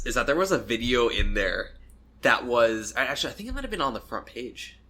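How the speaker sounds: usual speaking voice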